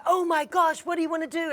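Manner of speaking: high-pitched startled reaction; imitated alarm. Nail tech reacting to customer's emergency, imitated alarm.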